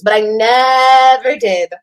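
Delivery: "never" drawn way out; slow, emphatic "never"; stress on never. Real, not acted. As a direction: stretch "never" long and hard for emphasis. Emphatic denial, stretched word.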